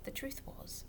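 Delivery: soft whispered confidence; whispered aside. A narrator's reading. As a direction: lean in and whisper. Whispered narration.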